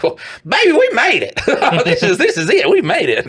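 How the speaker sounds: giddy wife squealing we made it; mock wife voice. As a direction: play the wife cheering with a giggle. Voicing wife's reaction to paycheck.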